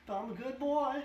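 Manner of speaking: a dazed young man voiced like a child; confused boy. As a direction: plead it, bewildered and small. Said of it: "looks up with a confused expression and says".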